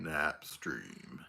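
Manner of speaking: sudden low, quiet goofy voice; silly deep voice. Pretend. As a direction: drop into a deep joke voice. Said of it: "What is that?".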